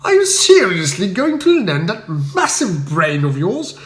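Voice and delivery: Bertie scolding his valet in dismay; a toff in high-pitched outrage; stern indignation. A narrator's reading. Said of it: "I inquired sternly".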